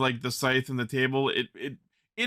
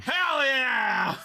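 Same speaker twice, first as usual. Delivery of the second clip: excited shout; 10 semitones higher than usual. Real, not acted. Cheering a casting idea.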